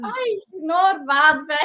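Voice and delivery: slow put-on Scottish accent; playful Scottish impression. Shown as a demonstration. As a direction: stretch it out in a Scots voice. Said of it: "We're doing a Scottish".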